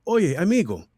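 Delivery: lightly performed commercial voice; ad read voice. Retold or imitated. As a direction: read it like a PSA spot. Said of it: "the first line was".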